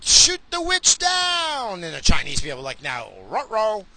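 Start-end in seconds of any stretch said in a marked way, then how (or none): 0.0-1.8 high, loud, drawn-out mock cry; hammed-up crowd chant; rallying crowd shout. Redoing the Americans' cheer. 2.8-3.8 slow, lightly cartoonish uh-oh; ruh-roh voice. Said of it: "I could do the ruh-roh part".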